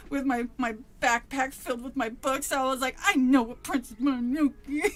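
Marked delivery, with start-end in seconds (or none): 3.0-4.9 louder, laughing and half-crying protest; laughing indignation. Retold or imitated. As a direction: laugh through the outrage. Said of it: "I was raging the whole time".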